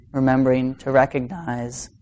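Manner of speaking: ordinary talk, nothing marked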